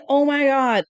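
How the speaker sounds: a flustered, incredulous gasp at the gossip; exasperated oh my God. Retold or imitated. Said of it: "And I'm going".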